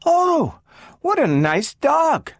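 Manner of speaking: put-on high, slow gushing child voice; little girl thrilled by a collie; delighted girl. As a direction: coo over the puppy.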